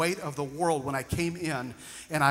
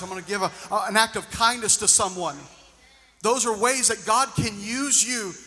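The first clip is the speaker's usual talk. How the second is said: louder preaching that speeds up on the charge; rousing preaching; 4 dB louder than usual. Acted. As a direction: push the congregation to act. Preaching, voicing God's call.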